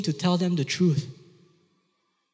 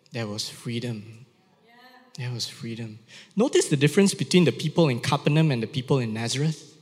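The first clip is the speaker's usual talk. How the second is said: ordinary delivery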